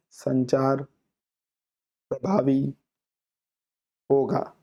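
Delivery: each word slowly with long pauses; slow, halting demo delivery; deliberately slow. Shown as a demonstration. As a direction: drag it out word by word to show slow speech. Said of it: "ऐसे मैं धीरे-धीरे बोल रहा हूँ".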